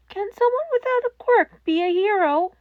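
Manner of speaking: put-on hopeful boy. A narrator's reading. Boy asks hopeful question.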